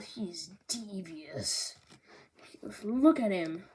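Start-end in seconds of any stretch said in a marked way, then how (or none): 0.0-1.8 hushed and slow, like sharing a secret; devious whisper. Acted. Narrating the villain Wapol, a sly whisper. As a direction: breathe it out slyly.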